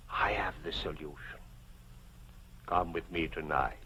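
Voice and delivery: hushed conspiratorial tip; whisper. Acted. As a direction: lean in and whisper. Conspiratorial uncle offer.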